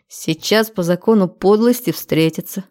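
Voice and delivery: ordinary delivery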